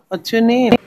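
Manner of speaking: normal talking voice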